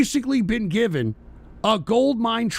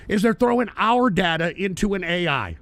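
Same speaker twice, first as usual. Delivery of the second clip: ordinary talk, nothing marked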